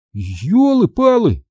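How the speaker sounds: high, drawn-out exclamation; startled cry. Acted. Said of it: "вскрикнул я". (протяжный возглас досады)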